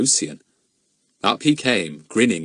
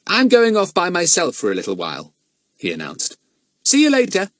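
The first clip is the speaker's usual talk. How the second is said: put-on a touch higher, brisk boy's voice; lightly voiced boy; 10 semitones higher, 4 dB louder than usual. A narrator's reading. Jack telling the others he is off alone, lightly voiced. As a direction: announce it casually. Jack's voice.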